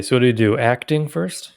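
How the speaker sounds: ordinary talk, nothing marked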